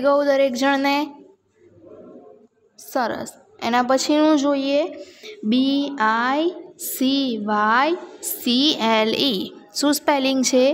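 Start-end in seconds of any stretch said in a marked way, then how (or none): 5.5-9.4 very slow, letter-by-letter spelling; slow classroom spelling with gaps. Shown as a demonstration. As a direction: draw out each letter for the class. Teacher spells word for class.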